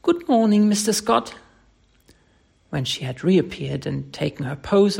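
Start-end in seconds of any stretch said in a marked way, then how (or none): 0.0-1.3 high, bright-spirited singsong hello; merry greeting. Acted. Tessie greets the painter on arriving, merry and high. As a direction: call out merrily, pitched up. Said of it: "with a merry".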